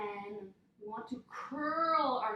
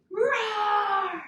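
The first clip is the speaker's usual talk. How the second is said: slow, loud, growling bear roar; 8 semitones higher, 9 dB louder than usual. Shown as a demonstration. Yoga teacher roars like a bear with the kids. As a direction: let it rip like a big bear. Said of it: "one more time".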